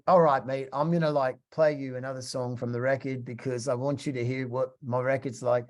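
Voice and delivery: casual imitated British English; British accent. British musician offering to play a song, imitated British accent. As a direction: put on a matey British accent. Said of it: "he's got a British accent".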